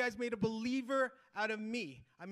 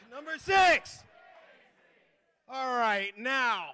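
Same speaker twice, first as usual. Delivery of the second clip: usual speaking voice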